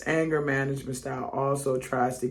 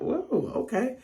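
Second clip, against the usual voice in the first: much higher sarcastic "Whoa, okay"; 11 semitones higher, 3 dB quieter, slower than usual. Retold or imitated. Coworker mocking you in a meeting, sarcastic fake awe. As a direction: pretend to be impressed, dripping sarcasm. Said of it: "they'll be sarcastic".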